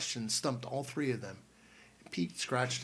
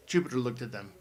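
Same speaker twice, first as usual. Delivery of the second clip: usual speaking voice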